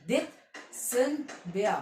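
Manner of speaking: दिसंबर very slowly, "det... sem... bea"; syllable-by-syllable pronunciation. Shown as a demonstration. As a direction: break दिसंबर into three separate syllables. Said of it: "कैसे pronounce करते हैं".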